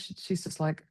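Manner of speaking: ordinary talk, nothing marked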